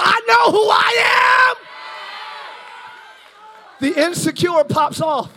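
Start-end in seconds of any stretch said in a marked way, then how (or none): 0.0-3.9 slow, higher and louder climax; preacher crowning the point, slow and loud; preaching peak. Acted. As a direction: stretch it out at full force.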